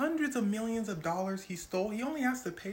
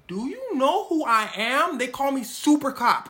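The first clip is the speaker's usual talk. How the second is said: loud, slow, higher swaggering cop; Super Cop bragging to Hush Puppy, loud and slow; boastful Super Cop; 8 semitones higher, 10 dB louder than usual. Retold or imitated. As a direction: puff up like an untouchable cop. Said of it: "he said".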